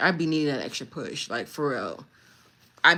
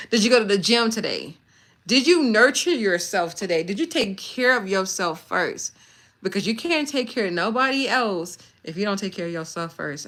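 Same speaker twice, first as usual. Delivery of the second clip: louder, higher preaching push; 3 semitones higher, 5 dB louder than usual. Acted. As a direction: preach it at your followers like a sermon. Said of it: "Ooh, I'm preaching right now".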